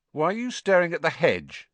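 high-pitched, suspicious question; wary bully's questioning. A narrator's reading. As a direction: demand it warily. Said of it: "he said suspiciously".

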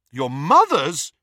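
loud, drawn-out astonished echo. A narrator's reading. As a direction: echo it back in disbelief. Harry astonished by the slip.

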